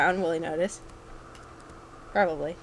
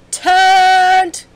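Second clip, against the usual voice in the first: loud, high drawn-out cheer; hyped exclamation; 11 semitones higher, 12 dB louder than usual. Real, not acted. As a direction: belt it out high. Exclaims while playing.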